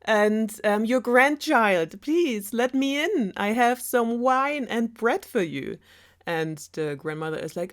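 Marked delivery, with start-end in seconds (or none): 0.0-5.8 put-on much higher, louder girly voice; wolf hamming up a sweet little girl; wolf faking Red. Wolf at the door pretending to be Red, high voice. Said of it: "the wolf can change his voice".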